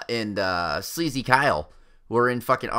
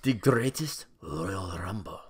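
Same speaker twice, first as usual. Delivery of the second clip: mock Saudi prince; 5 semitones lower, 6 dB quieter, slower than usual. Repeats the prince's line.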